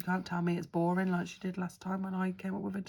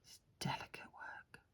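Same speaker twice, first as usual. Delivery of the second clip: hushed whisper while concentrating; 14 dB quieter than usual. Real, not acted. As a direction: breathe it out softly. Concentrating on nail art.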